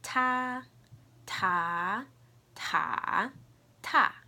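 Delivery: loud "Ta" four ways: high level, rising, dipping, falling; four tones demo. Shown as a demonstration. Teacher modelling for learners, four tones on "Ta". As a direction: step "Ta" through the four Mandarin tones, last one short. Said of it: "I'll say the four tones".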